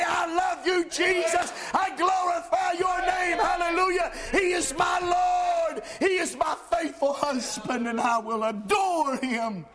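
high, loud worship cries; shouted praise. Acted. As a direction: proclaim it with lifted hands. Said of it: "lift my hands and say".

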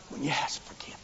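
whispered, intimate aside. Real, not acted. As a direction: drop to a whisper. Hushed climax of point.

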